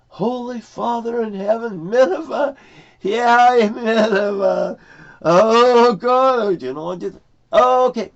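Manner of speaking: high, loud wailing protest; acted exasperated outcry. Prophet protesting Nineveh to God, high loud exasperation. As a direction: cry out to heaven, high and loud.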